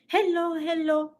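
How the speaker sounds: high, drawn-out hello; greeting strangers in a lifted, stretched voice; acted greeting. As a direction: sing out a slow, high greeting. Acts out greeting the men.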